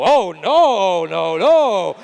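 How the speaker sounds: put-on loud, shocked old priest; horrified priest. Deaf priest reacts to the confession, loud shocked horror.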